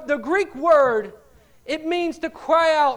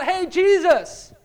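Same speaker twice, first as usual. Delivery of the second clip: casual drawn-out call, slightly raised; ordinary crying out; 5 semitones higher, 3 dB louder, much slower than usual. Shown as a demonstration. Preacher showing what the men weren't doing, an ordinary call. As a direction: call out as if just getting his attention. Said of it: "they weren't just crying out".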